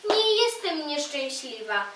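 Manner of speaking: ordinary delivery, nothing marked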